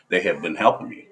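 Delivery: normal talking voice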